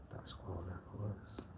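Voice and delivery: breathy hushed whisper; dramatic hush before the outburst. Acted. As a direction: whisper it like a secret. Whispered then repeated loud.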